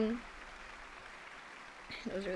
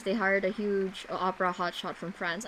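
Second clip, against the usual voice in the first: ordinary delivery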